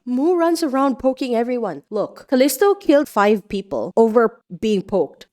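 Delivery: normal talking voice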